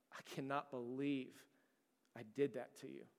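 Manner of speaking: hushed, low voice with heavy pauses, imitating remorse; quiet, halting mock confession, understated; retold tearful remorse. Boss confessing to the pregnant employee he wronged, subdued remorse. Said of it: "He just started weeping".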